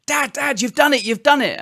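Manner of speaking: son shouting for his dad, high-pitched; high imitation of a child's shout; imitated excited shout. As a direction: call it out high and urgent like an excited kid. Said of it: "Nathan just suddenly started shouting".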